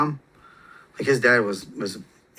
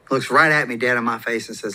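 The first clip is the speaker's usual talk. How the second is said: normal talking voice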